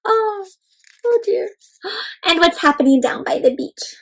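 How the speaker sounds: ordinary delivery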